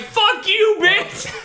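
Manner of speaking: high yell at the screen; outraged shout. Retold or imitated. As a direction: yell it in disbelief. Yelling at the credits.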